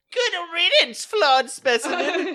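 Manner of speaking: very high, loud, silly put-on voice; villain's supposed real voice. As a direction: proclaim it in an absurd high villain voice. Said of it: "switch into my real voice".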